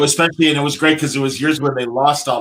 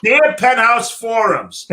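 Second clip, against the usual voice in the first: mock letter reading; 7 semitones higher, 5 dB louder, slower than usual.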